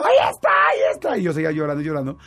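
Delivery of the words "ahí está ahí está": very high, loud frightened cry; panic, pointing at the monster; scared child shrieking to his mom; terrified cry. Retold or imitated.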